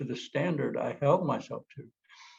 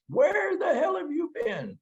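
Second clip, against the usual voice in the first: high, loud shouted accusation; angry demand; 4 dB louder than usual. Retold or imitated. Partner demanding where he was, shouting in anger. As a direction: yell at a partner who came home late. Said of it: "in a not so calm voice".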